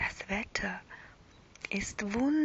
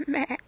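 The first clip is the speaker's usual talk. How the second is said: a little whispered sheep meh, higher; 6 semitones higher, 4 dB louder than usual. Acted. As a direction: bleat gently like a toy lamb. Lamb Polly giggles and bleats.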